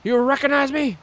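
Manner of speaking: high, wounded complaining voice; confused whiny wrestler. Pretend. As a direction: whine it up high like a hurt hero nobody recognizes.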